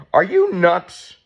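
slow, high and loud: are you nuts; incredulous. Real, not acted. Vlogger scolding other patients, loud disbelief.

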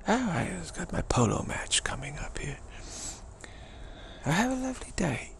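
put-on high, lilting British accent; British polo gent. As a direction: take airy leave as a British polo player. British polo player bidding goodbye.

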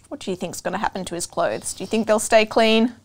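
plain reading voice

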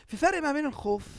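normal talking voice

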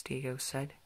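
usual speaking voice

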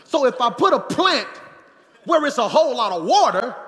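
loud, high preacher punchline; hammed-up shouted reasoning; mock-logical shout. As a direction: argue it like it's obvious. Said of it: "I said".

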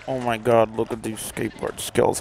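low, put-on bragging voice; low silly voice. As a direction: drop the voice low and brag. Odd low voice.

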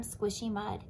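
ordinary delivery

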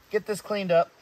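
ordinary talk

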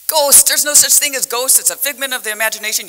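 dismissive retort. Retold or imitated. Dad dismisses talk of ghosts at home, mock dismissive retort. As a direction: wave it off loudly, then rattle on.

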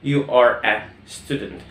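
You are a student stretched very slowly; teacher modelling each word; slow, clear classroom drill; slow model sentence. Shown as a demonstration. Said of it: "بقول عنك أنت".

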